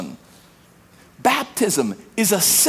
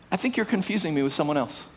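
polite brush-off; 4 semitones lower, 7 dB quieter, much faster than usual. Acted. Acted reply to sin.

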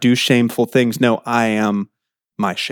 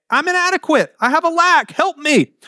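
much higher, pleading plea for help; pleading cry; 13 semitones higher than usual. Acted. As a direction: cry out high like a needy child. Crying out for help.

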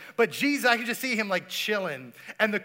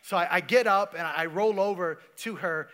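usual speaking voice

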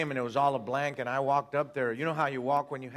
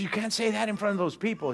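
high-pitched mock alarm; retold pleading scold, pitched up; alarmed preacher; 9 semitones higher than usual. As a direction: imitate a fretting preacher. Preacher's acted worried rebuke.

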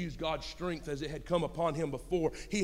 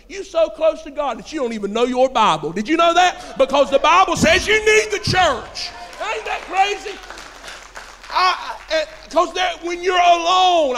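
loud, high, shouted sermon; impassioned church shout; full-force preaching; 9 semitones higher, 9 dB louder than usual. Real, not acted. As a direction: preach it at the top of your voice.

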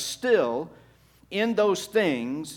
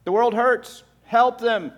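higher and louder, pressing the plea home; forceful repeat; 8 semitones higher, 7 dB louder than usual. Shown as a demonstration. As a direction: hammer the message the second time, higher and louder. Same message repeated with force.

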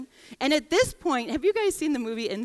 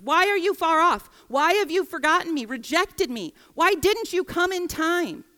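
higher, louder pleading why; praying lament, higher and louder; anguished plea; 6 semitones higher, 4 dB louder than usual. Acted. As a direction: cry out to God in frustration. Voicing lament to God.

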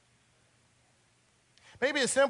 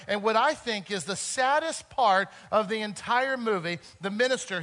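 normal talking voice

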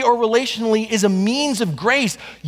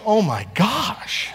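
aghast murmur; much slower than usual. Pretend. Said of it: "You'd be out there going".

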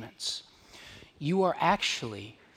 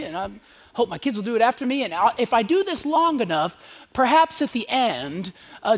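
higher, louder self-satisfied voice playing the comfortable believer; smug churchgoer; 6 semitones higher, 5 dB louder than usual. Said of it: "live out of this place of like".

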